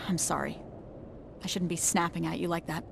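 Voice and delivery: hushed and contrite; subdued apology. Acted. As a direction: back down and apologise quietly. Apologises after snapping at teammate.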